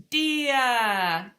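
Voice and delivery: put-on loud, high, drawn-out toddler word; imitating toddler. Mum imitating her toddler's first words, loud drawn-out toddler voice. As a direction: stretch it out loudly like a toddler. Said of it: "him just saying these words".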